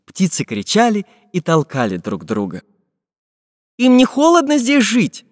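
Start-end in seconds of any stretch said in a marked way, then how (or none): 3.8-5.3 high-pitched wondering question; Чижик surprised. A narrator's reading. Чижик wonders about penguins in the cold, high surprised voice. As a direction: ask it in high, puzzled amazement. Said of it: "удивился Чижик".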